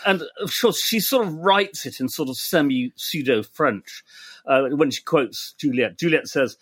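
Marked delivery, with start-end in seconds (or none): none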